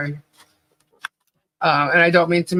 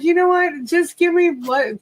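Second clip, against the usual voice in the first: loopy demand; 9 semitones higher than usual. Retold or imitated. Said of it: "I was so loopy".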